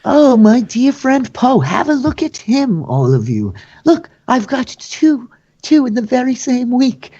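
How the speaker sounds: high, gushing showman voice; Vergen. Acted. Host showing off dear friend Poe to all, high and theatrical. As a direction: parade a prisoner before the crowd, mock-affectionate.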